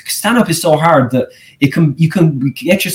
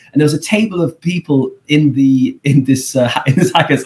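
ordinary talk

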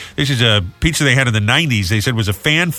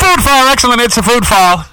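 segment announcer; 10 semitones higher, 6 dB louder than usual. Acted.

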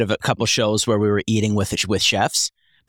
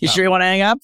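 high mock phone prompt; 8 semitones higher, faster than usual.

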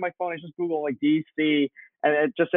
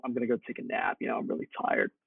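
much softer, fading into a whisper; tired mumble; 3 semitones lower, 9 dB quieter than usual. Retold or imitated. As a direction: murmur it like you're half asleep already. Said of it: "and I told him".

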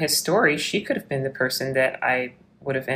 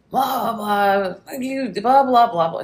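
mock ranting; 7 semitones higher, 4 dB louder, slower than usual. Mocking the man's angry voicemail rants.

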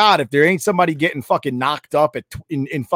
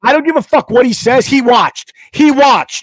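loud, high, heated insistence; angry shout; 9 semitones higher, 7 dB louder than usual. Real, not acted. Heated podcast host yelling at his co-host. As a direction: yell it like you can't let it go. Angry rant about watching.

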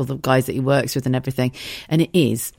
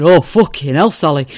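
loud exasperated groan; 6 dB louder than usual. Retold or imitated. Husband fed up with his wife's confusion, loud exasperated. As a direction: give up in exasperation. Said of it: "He went".

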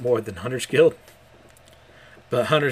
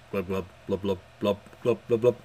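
gurgling; 4 semitones lower, 3 dB quieter than usual. Acted. Mash gurgling in the water cage.

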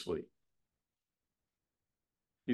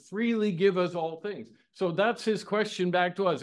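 preaching at full force; 6 semitones higher than usual. Acted. Preacher urging the congregation about God's generosity, impassioned. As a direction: lift the voice and press the point home.

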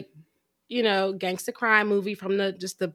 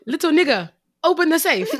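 loud mock Ghanaian accent, pitched up; higher-pitched, badly faked Ghanaian accent; Michael Blackson accent; 7 semitones higher, 5 dB louder than usual. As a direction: put on a hammed-up Ghanaian accent. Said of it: "Michael Blackson".

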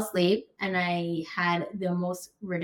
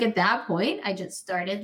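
ordinary delivery

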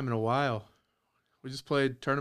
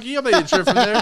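higher, louder hammed-up outburst; mock refusal; 8 semitones higher, 10 dB louder, faster than usual. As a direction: bark it back like you're offended by the offer. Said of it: "I'd be like".

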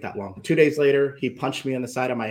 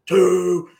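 threatening dad; 4 semitones higher than usual. Retold or imitated. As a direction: count it down like an angry father. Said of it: "I can hear him".

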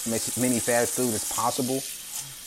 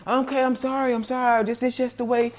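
higher, whiny mock apology from the worker; 10 semitones higher than usual. Customer mimics the cashier's flustered apology, whiny and high. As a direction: ape the worker's flustered sorry, pitched up.

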